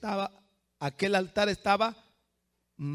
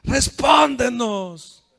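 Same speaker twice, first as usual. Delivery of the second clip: high, loud, drawn-out invocation; long wailing call; pleading shouts to a god; 8 semitones higher, 13 dB louder, much slower than usual. Acted. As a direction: cry out like frantic prophets. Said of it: "¿Y sabe cómo decían?".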